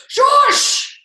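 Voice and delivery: high, loud sharp shush. Acted. Koala silencing whoever called him bear, loud and high. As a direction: cut them off with a shrill hush.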